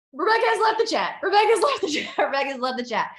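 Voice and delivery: high, loud, teasing announcement; mock announcement. Mock announcement of leaving chats.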